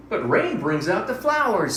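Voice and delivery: high, bright little mouse; squeaky mouse voice. Acted. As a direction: voice a tiny excited mouse. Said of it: "said Mouse".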